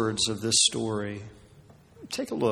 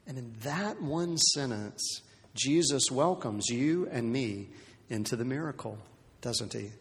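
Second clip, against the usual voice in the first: normal talking voice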